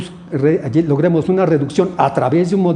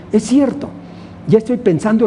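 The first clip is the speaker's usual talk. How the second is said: lightly acted student realizing it, higher pitched; student's realization; 7 semitones higher than usual. Teacher voicing students who review earlier studies, lightly acted realization. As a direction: raise the pitch, as if it just dawned on you. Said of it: "van a decir".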